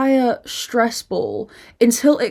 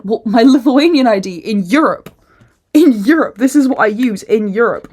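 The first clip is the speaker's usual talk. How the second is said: louder, pointed mock argument; exasperated insistence; 2 semitones higher, 6 dB louder than usual. Traveller argues ID with the official, loud exasperation. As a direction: push hard on "Europe".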